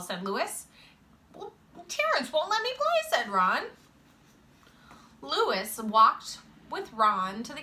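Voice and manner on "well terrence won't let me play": put-on very high kid's complaint; complaining child. A narrator's reading. Boy complaining to a friend about being left out, high-pitched.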